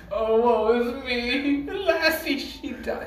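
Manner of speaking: high, slow mock lament; mournful wail. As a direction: wail it like a grieving melodrama. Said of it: "The co-op says, like".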